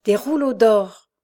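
stunned cry of gold; awed exclamation. A narrator's reading. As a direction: let each word land in wonder. Gold found in the baby's swaddling.

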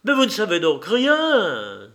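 Stéphane cries out at his teacher; exclaiming. Acted. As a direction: cry it out at him. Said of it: "s'écria-t-il".

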